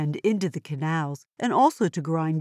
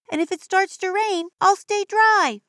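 put-on broad singsong kids theatre; 12 semitones higher, 4 dB louder than usual. Excited kid character on boat.

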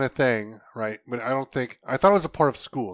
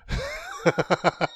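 stifled giggles; 6 semitones higher than usual. Real, not acted. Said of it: "she's trying not to laugh over here".

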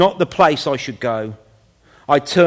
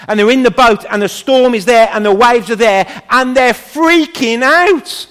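building alarm; 6 semitones higher, 6 dB louder than usual. Retold or imitated. Preacher retelling disciples in the storm, alarm building to a high peak. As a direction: storm story climbing higher and louder.

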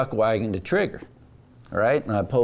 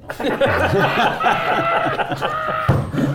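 high, open burst of laughter; real laugh. Real, not acted. Guest laughing at his own bad luck, real laugh.

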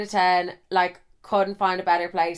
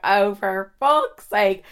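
loud, drawn-out exasperated groan of words; mock exasperation, slow and heavy; retold exasperation; 5 dB louder, much slower than usual. Friends react to her crying again, exasperated.

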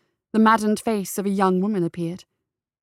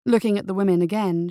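plain reading voice

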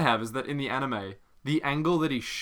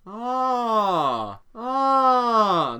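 dawning realization; 10 semitones higher, 5 dB louder than usual. Real, not acted. Realizing the trick.

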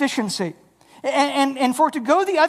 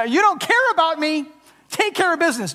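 higher, mildly indignant complaint; complaining Martha; 6 semitones higher than usual. Retold or imitated. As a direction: whine a bit at Jesus like an overworked hostess. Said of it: "Martha has levied this, uh, criticism".

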